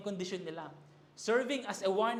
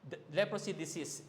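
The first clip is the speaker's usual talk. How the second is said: ordinary delivery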